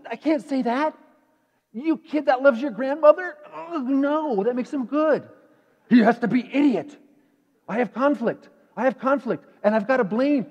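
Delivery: high, loud, hammed-up self-justifying rant; mocking inner voice. Preacher voicing his own blaming thoughts, hammed-up and loud. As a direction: play the petty mind justifying its anger.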